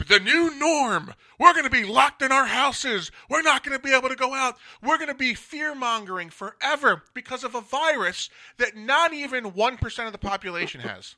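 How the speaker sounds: loud, high-pitched exasperated rant. Real, not acted. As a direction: raise the voice high and vent, drawing out the opening words. Exasperated rant about pandemic fear.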